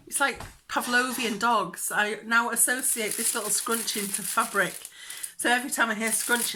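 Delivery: ordinary delivery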